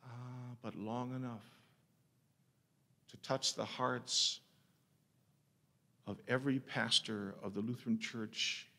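ordinary delivery

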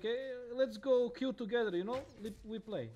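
high, loud carefree buddy; mock a careless casual player; casual gamer voice. Mocks casual players queueing together.

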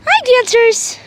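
loud, high greeting from a distance; cheery call. Real, not acted. Greeting horses from afar.

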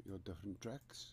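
ordinary talk, nothing marked

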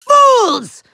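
high villain outburst; exasperated shout. Acted. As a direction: yell it at useless minions. Villain scolds his minions.

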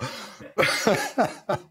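high, wheezy belly laugh; helpless laughter. Real, not acted. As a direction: crack up at your own punchline. Laughing at his own punchline.

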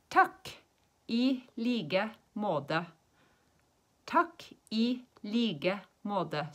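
very slow, "like" with a G for K, "måte" with a D for T. Shown as a demonstration. Teacher answering nice to meet you, slowly, G in "like", D in "måte". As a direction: soften "like" to a G sound and "måte" to a D. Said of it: "we say it like a G".

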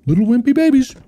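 put-on high sneering baby taunt; mocking taunt. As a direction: tease the ghost. Taunting the ghost.

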